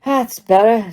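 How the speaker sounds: talking through mashed potato; words round a mouthful; mouth full. Acted. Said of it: "with his mouth full of mashed potato".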